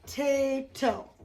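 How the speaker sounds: "Potato" slowly in three separate beats; broken into syllables. Shown as a demonstration. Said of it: "So that's three syllables".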